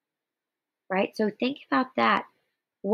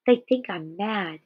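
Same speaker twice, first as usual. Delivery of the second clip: ordinary talk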